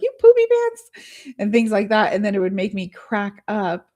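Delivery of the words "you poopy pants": put-on high, loud, slow kid taunt; pretend-furious little kid in the car; childish insult. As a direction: drag it out like a silly playground jeer. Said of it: "but I'd be like".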